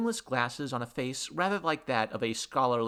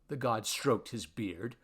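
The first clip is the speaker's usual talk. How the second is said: usual speaking voice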